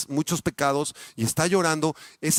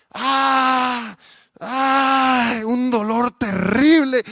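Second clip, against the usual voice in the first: mock cry of pain, higher and louder; drawn-out pained wail, louder; 8 semitones higher, 6 dB louder, much slower than usual. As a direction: cry out as if in agony. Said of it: "estuviéramos gritando de dolor".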